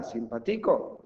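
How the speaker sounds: simpaTIco, beat on the third syllable; Simpático misstressed as simpaTÍco; wrong stress on TI. Shown as a demonstration. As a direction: lean slowly on TI in Simpático. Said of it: "¿Esta?".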